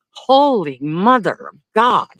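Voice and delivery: disbelief. Real, not acted. Ranting at people's stupidity.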